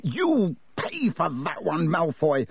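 mildly voiced angry yell; a touch of an enraged yell. A narrator's reading.

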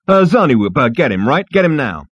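loud, pushy opening; impatient demand. Acted. Zaphod orders the receptionist about, impatiently. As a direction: push the receptionist to act at once.

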